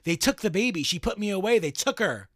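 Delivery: a light take on a ghost protesting his innocence; accused spirit crying out that he didn't do it. Acted. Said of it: "The entity cried out".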